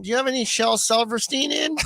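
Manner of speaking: fake book shopper. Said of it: "you ask the question".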